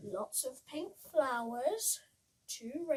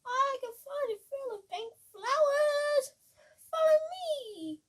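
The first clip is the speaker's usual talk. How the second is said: high, loud bee voice; the bee offers to lead them, loud and high; 12 semitones higher, 11 dB louder than usual. Acted. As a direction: buzz like a busy bee. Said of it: "a big striped bee".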